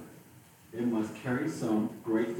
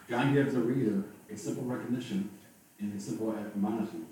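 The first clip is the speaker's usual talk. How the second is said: normal talking voice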